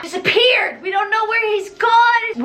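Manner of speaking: high, emphatic punchlines; dramatic exclaiming. Real, not acted. Storyteller revealing the Phantom vanished, high dramatic exclaiming. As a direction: push it high with theatrical amazement.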